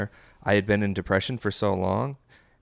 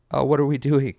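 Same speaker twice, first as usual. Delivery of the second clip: higher, louder inner voice; brain scolding itself; 6 semitones higher, 5 dB louder than usual. Retold or imitated. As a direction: act out your brain suddenly protesting. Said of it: "my brain just kinda went".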